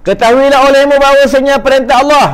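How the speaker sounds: high, loud declaimed recital; bacaan teks kitab. Acted. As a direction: proclaim the text at full voice. Reads out the lesson text. (membaca kitab dengan suara tinggi dan lantang)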